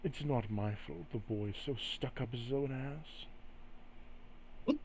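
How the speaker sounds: soft, sly retort; hushed and needling, shrugging off blame; taunting spirit. Acted. Entity excuses its teasing of Graves, quietly mocking. Spirit answers telepathically in character.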